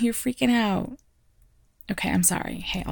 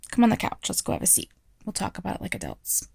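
ordinary delivery

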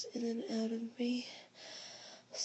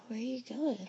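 whispering; 2 semitones higher, faster than usual. Acted. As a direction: whisper it close to the ear.

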